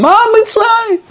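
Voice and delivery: high, mocking question through a laugh; scoffing disbelief. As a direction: scoff at the idea as if it's absurd. (שאלה מלגלגת וגבוהה בצחוק)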